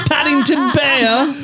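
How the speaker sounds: high, slow drawn-out British voice; hammed-up high British accent; mock British accent. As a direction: say it like a dotty Englishwoman. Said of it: "make fun of her accent".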